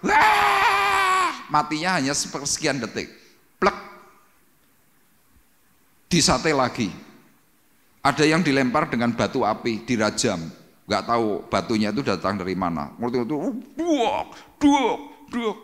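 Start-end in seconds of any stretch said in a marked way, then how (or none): 0.1-1.5 piercing high cry of pain; a shriek of someone being tortured; agonized scream. Retold or imitated. Tortured soul in hell screaming, piercing shriek. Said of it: "Cuma bisa teriak". 13.8-15.6 impact noises. Retold or imitated. Stones striking the damned.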